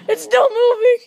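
ordinary delivery

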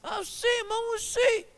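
high-pitched, fretful questions; mock-nervous high voice; jittery policemen. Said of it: "עצבניים".

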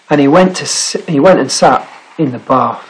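plain reading voice

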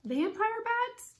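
very high, drawn-out rising question; stretched, soaring incredulous echo; shocked question. A narrator's reading. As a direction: climb steeply in slow disbelief. Annie's startled echo of Jack.

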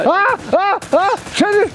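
high frustrated yell; frustrated shout. Real, not acted. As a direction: cry out in annoyance. Hit in game.

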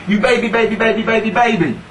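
high, rapid mocking chant; taunting boy. Boy picking on a girl on the bus, taunting. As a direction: tease like a playground bully. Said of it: "the boy was going".

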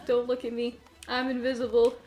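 usual speaking voice

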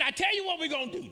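high, loud, rapid-fire outburst; re-enacted outburst. Preacher re-enacting his answer to the cussing guy, high loud outburst. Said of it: "That's exactly how I said it".

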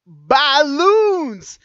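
loud, high, drawn-out cry; triumphant shout. Real, not acted. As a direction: shout the big reveal and stretch it out. Punchline after guess what.

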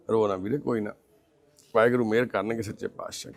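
low, soft reassurance; gentle consoling. Real, not acted. Comforting a crying blind man, gently consoling. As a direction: soothe him quietly, voice dropped.

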